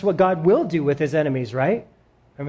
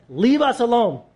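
pleading demon; 6 semitones higher than usual. Pretend. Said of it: "He says".

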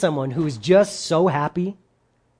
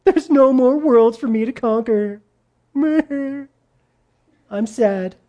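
whiny, higher, tearful lament with a laugh; mock crying; 5 semitones higher, much slower than usual. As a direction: play a pouting conqueror bemoaning his lot, higher. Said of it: "he said in his tears".

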